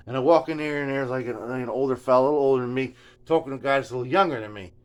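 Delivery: ordinary talk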